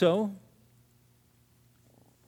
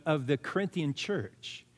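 ordinary talk

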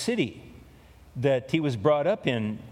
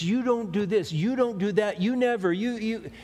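raised, higher nagging blame; accusing you words; 6 semitones higher than usual. Retold or imitated. Spouse blaming in an argument, mock accusing. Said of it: "when they get in an argument".

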